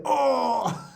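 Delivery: high, squawked Australian "Oh!"; Australian exclamation. Retold or imitated. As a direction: yelp "Oh!" like a broad Australian Ron. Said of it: "switch to that Australian accent".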